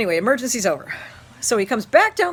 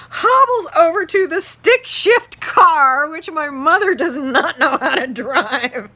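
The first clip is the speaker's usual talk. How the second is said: higher, louder words broken by laughter; laughing while talking; 9 semitones higher, 7 dB louder, slower than usual. Real, not acted. Teacher telling his class about the broken knee, laughing. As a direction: get the words out through laughing.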